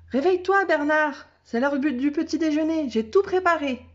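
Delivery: put-on high, lively child's voice; a small girl coaxing her dog awake; little girl voice. A narrator's reading. Little girl waking her dog, high and eager.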